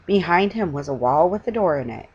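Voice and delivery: usual speaking voice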